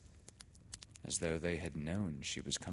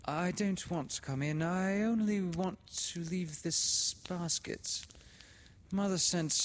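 put-on high, pleading, louder girl; Laura, anxious; 14 semitones higher, 4 dB louder than usual. A narrator's reading. A frightened girl pleading to leave the basket, anxious. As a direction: beg to just leave the basket. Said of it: "said Laura".

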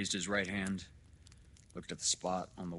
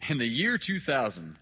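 loud, higher, declamatory opening; lecturing doctor; 6 semitones higher, 10 dB louder than usual. Acted. Doctor lecturing father on history, loudly declaiming. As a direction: launch the lecture loud and high.

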